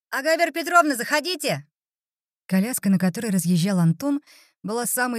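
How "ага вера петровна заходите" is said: put-on teen boy voice. Teen boy greets visitor.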